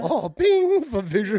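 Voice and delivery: high-pitched, laughing mock surprise; hammed-up discovery. Acting out staff finding his file, hammed-up discovery. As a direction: pipe it up high, half laughing.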